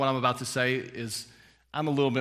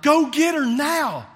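high, loud, slow urgent order; wife yelling at her husband to save their daughter; urgent command; 14 semitones higher, 9 dB louder, slower than usual. Retold or imitated. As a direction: shout the order to go get her. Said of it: "And Jeannie's like".

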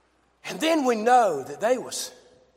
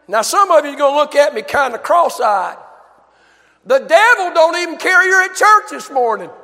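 raised, driving sermon voice; fervent preaching; 9 semitones higher, 9 dB louder than usual. Real, not acted. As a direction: preach it with fire. Preaching at full force.

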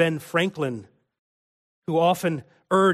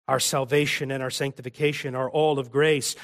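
normal talking voice

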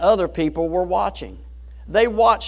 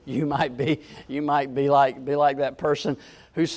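ordinary talk

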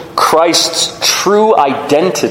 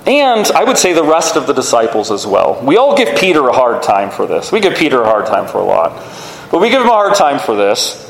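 ordinary delivery

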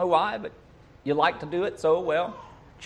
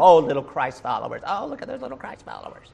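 mock taunting voice; mocking sneer; 3 semitones lower, 4 dB quieter than usual. Imitating first-century mockers, sneering taunt. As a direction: sneer at the little Christ followers. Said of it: "a term to mock them".

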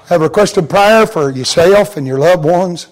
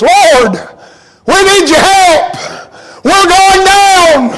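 Paul's earnest prayer; 11 semitones higher, slower than usual. Retold or imitated. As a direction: plead like a drowning man. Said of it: "his prayer probably went something like this".

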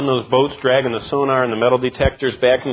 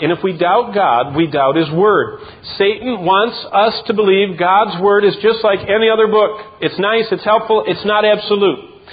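higher and louder, pounding each line; preaching at full force; 6 semitones higher, 3 dB louder than usual. Acted. Pastor warning the church about Satan, preaching at full force. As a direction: hammer every He wants.

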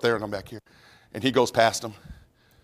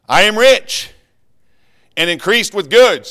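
smug church voice; 6 semitones higher, 7 dB louder, slower than usual. Acted.

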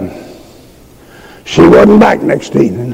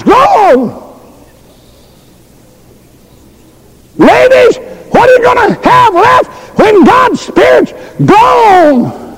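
preaching at full force. Real, not acted. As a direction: hammer each question home to the crowd. Preacher's climactic whooped appeal.

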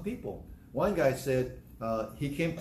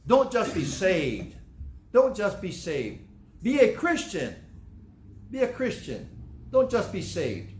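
forceful preaching; 3 semitones higher, 3 dB louder, slower than usual. Acted.

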